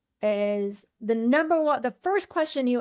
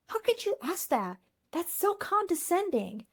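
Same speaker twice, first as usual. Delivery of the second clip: offended, higher and quieter; indignant; 6 semitones higher, 5 dB quieter than usual. Retold or imitated. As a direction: voice someone taking offence. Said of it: "I had somebody say".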